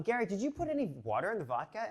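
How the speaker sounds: calling upstairs. Retold or imitated.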